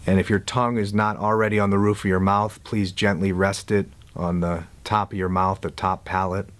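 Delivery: calm guide with long silences between instructions; guided meditation. Real, not acted. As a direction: ease them into letting go, unhurried.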